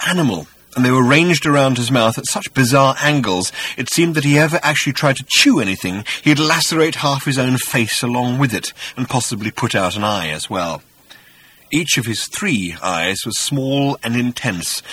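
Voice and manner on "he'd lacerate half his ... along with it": ordinary talk, nothing marked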